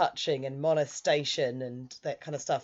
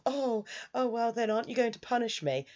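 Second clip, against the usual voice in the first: flirty tease; 6 semitones higher than usual. Retold or imitated. Jealous friend teasing lover.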